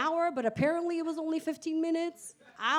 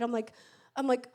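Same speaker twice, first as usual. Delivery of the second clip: ordinary talk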